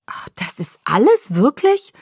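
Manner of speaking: breathy start leaping to a high, delighted question; beaming relief. A narrator's reading. Jacob interrupts Bella, beaming with relief. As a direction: burst into amazed relief. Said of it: "strahlte übers ganze Gesicht".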